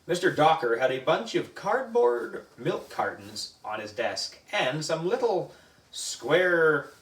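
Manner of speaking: ordinary delivery, nothing marked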